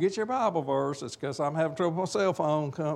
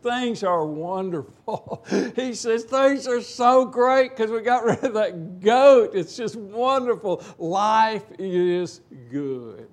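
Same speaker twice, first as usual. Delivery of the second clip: higher, louder, slow gushing joy; excited; 6 semitones higher, 6 dB louder, slower than usual. Retold or imitated. Relieved man tells the rabbi life is great, gushing excitedly. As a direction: beam with relief, drawing it out. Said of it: "man, he is excited".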